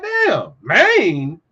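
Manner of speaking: hammed-up high shocked voice; mock shock. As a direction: act out gaping disbelief. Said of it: "You'll be like".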